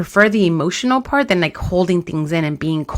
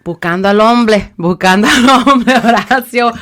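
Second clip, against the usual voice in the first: high, loud mock caller, breaking into laughter; playful prank-call voice; 4 semitones higher, 7 dB louder than usual. Prank reply to brother on phone.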